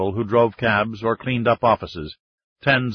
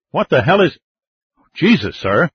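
higher, startled outburst; startled blurt; 5 semitones higher, 6 dB louder than usual. A narrator's reading. Young petty officer recognizing the President, blurting. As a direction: blurt it out in surprise. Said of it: "the young petty officer blurted".

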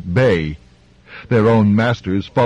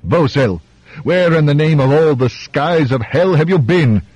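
roaring tyrant king; 6 semitones higher than usual. Acted. King demands of his doctor.

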